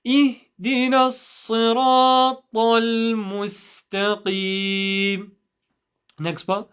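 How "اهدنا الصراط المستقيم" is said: slow, higher chanted recitation; measured Quranic recitation; nice and slow. Shown as a demonstration. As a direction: lead students slowly through long held vowels. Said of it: "nice and slow".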